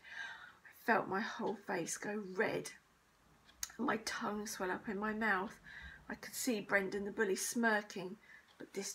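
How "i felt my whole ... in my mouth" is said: usual speaking voice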